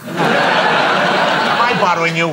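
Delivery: Columbo impression. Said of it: "Nice try, Columbo".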